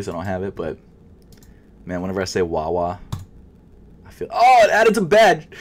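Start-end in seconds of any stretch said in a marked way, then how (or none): 4.3-5.5 shriek. Real, not acted. Shrieks over Wawa accent joke.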